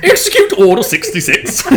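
hammed-up choking old emperor; choking Palpatine. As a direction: strangle the command like an old British man. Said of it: "an old British man choking on an old British man".

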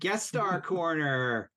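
slow, drawn-out segment title; announcer voice. Acted.

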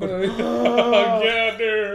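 real laughing exclamation. Real, not acted. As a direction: agree while cracking up. Laughing along at the joke.

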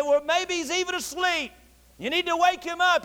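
high-pitched taunting suggestion; mocking. Elijah teasing prophets about their sleeping god, mocking. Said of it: "He mocked and said".

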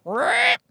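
high-pitched mock car scrape; high vocal sound effect of a scrape; imitated scraping noise. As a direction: imitate metal scraping on a pole. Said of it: "and I hear".

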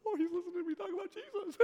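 high, fast excited squeal; hammed-up giddy aside; giddy delight. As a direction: squeal it quickly like a secret thrill. His giddy inner reaction.

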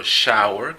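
"shower" with a clear English sh, rising; English word demo. Shown as a demonstration. Teacher giving an example of sh, careful English demo. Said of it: "las letras juntas S H, por ejemplo".

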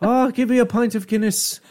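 high, boozy Irish priest caricature; hammed-up Irish accent. Priest character calling for Guinness, hammed-up Irish accent. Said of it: "I have the Irish accent".

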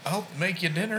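put-on high, soft quavery old lady; little old lady voice. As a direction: play a sweet old lady, softly. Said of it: "they get that little old lady voice".

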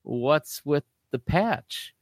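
high, slow, startled teacher; teacher surprised by the eye patch. Retold or imitated. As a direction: drag it out in disbelief. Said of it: "she's like".

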